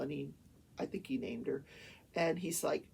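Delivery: ordinary talk, nothing marked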